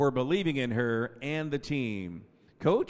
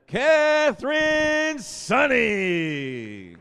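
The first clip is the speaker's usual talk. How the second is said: drawn-out ringing name call, much louder and higher; announcer call; 9 semitones higher, 10 dB louder, much slower than usual. Real, not acted. Announcer calls the next senior to the crowd, long ringing shout. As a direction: stretch the name out for the gym.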